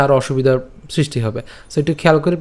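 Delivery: normal talking voice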